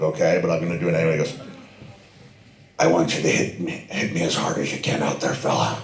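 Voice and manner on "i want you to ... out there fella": a lightly put-on, hushed Irish brogue; half-hearted Irish brogue, low and soft; terrible Irish brogue. Sheamus asking to be hit hard, in a mock Irish brogue. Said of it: "I do a terrible brogue".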